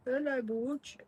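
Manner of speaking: very high, sing-song hello to the dog; high cooing greeting for a pet. Real, not acted. Greeting his dog Butch.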